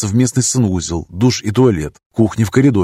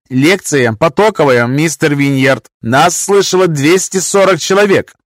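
stern professor; 5 semitones higher, 4 dB louder than usual. Acted. Professor warns student.

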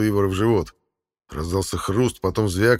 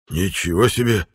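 hoarse and out of breath; raspy whisper; 4 dB louder than usual. Acted. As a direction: rasp it, panting after the fight. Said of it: "прохрипел он, дыша, точно загнанная лошадь".

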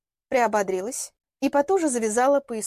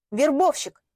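higher, emphatic, gleeful disclosure; gloating; 5 semitones higher, 4 dB louder, slower than usual. A narrator's reading. Girl reveals Вадим's real job to Лилиана, gloating. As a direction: deliver the word with open malicious relish. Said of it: "уже не старалась скрыть злорадство".

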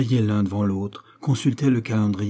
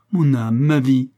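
louder, fervent adoration; impassioned endearment; 3 semitones higher, 6 dB louder, slower than usual. A narrator's reading. Woman clasping her lover in adoration, impassioned. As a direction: let the adoration swell.

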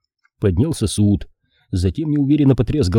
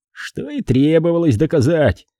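high-pitched, stern pronouncement; stern conclusion; 7 semitones higher than usual. A narrator's reading. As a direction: conclude severely in a raised pitch. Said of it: "сурово заключил Пейнтер". (суровый вывод высоким голосом)

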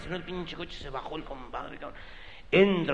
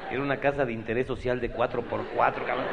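normal talking voice

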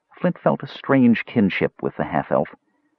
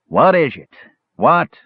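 snappish dwarf, louder; impatient dwarf; 8 semitones higher, 4 dB louder than usual. A narrator's reading. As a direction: snap at the visitor.

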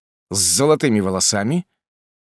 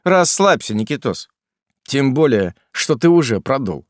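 higher, louder teasing jab; mocking; 5 semitones higher, 5 dB louder than usual. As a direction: needle him with a smirk. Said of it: "насмешливо хмыкнул в трубку приятель".

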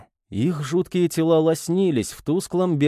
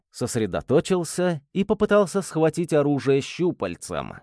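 ordinary delivery

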